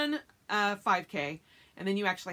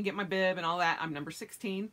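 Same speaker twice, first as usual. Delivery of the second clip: ordinary talk, nothing marked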